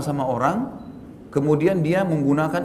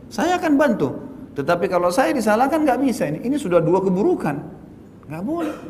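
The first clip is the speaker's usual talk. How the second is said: higher, firm and insistent; firm self-quote; 6 semitones higher than usual. Retold or imitated. Preacher retelling how he refused the blame, raised and firm. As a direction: stand your ground, promising help but refusing the blame. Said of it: "Gitu kan?".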